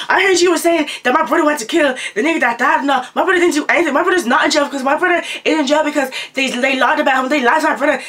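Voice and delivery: high-pitched angry rant, imitating her; fuming, turned up. Fuming girl confronting teller about her brother, high imitated rant. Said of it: "she's fuming, she was, like, turned up".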